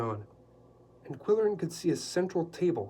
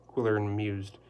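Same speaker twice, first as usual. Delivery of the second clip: ordinary delivery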